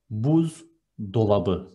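buzdolabı said slowly and carefully for learners; buzdolabı at half speed, each part clear; slow model word. Shown as a demonstration. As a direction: stretch out buzdolabı so the class can copy it. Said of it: "there is a compound noun here".

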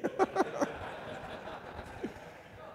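a high, airy burst of laughter; real laugh. Real, not acted. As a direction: laugh at the thought of not being married. Laughing at own joke.

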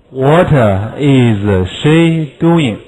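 very slow uncontracted what is; every word separate, what is unreduced; slow full form. Shown as a demonstration. Teacher shows the full form before the contraction. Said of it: "What is可以缩写".

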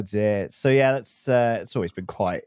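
usual speaking voice

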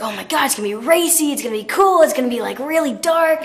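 excited gush. Retold or imitated. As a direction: rush the hopes out, thrilled. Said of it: "immediately I was like".

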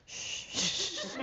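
soft, long hushing; soothing shush. Retold or imitated. Owner kneeling by a dying dog, soft shushing. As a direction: hush a dying pet gently.

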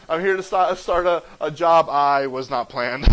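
low, mock-sheepish introduction breaking into a laugh; deadpan confession. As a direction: keep it low and awkward, then crack up. Acts out awkward job introduction.

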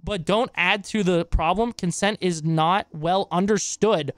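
normal talking voice

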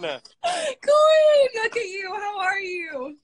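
very high, gushing squeal; real overjoyed high-pitched cry; ecstatic greeting. Real, not acted. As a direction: shriek a delighted hello to your idol. Greets guest who just joined.

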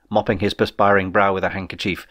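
ordinary delivery

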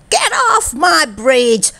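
high, drawn-out mock shout; storybook shout. A narrator's reading. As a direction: order them off in a high, slow storybook yell. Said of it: "shouted Posey".

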